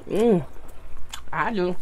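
chewing between words; muffled closed-lip speech; mouth full of crab. Real, not acted. Seen 4: Crab put in mouth at -1.0; lips closed, chewing while saying words.

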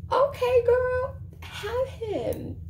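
high-pitched, dismissive handover; mock concession. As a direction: give him up in a high, sassy voice. Acts out her dismissive reaction.

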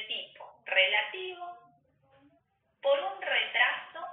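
"tipo relativo por un retraso" spelled out slowly; slow, stressed phrase. Shown as a demonstration. Teacher to students, stressing the key phrase slowly. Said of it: "acá remarco la palabra".

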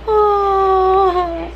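high, loud sob of feeling; crying oh. Real, not acted. Moved by the book quote, a loud tearful cry. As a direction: let out a choked, tearful oh.

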